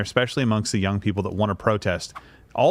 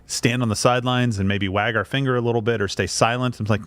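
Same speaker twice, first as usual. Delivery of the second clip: normal talking voice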